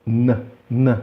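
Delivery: "n" curto, para dentro; som isolado do N. Shown as a demonstration. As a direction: repita um "n" sozinho. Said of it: "o som da letra N". (som de "n" isolado, repetido)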